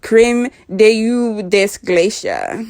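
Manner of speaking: slow mock French accent; reading foreign language. Shown as a demonstration. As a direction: read it slowly in a French accent. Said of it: "Try to read it in French".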